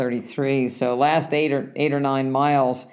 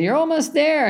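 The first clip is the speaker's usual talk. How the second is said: higher, sarcastic parroting of the cheer; mocking echo; 3 dB louder than usual. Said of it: "you hate when people say that".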